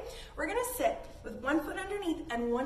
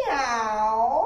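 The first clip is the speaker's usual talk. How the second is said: loud rising meow; playful loud cat sound; 9 dB louder than usual. Shown as a demonstration. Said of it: "giving the nice".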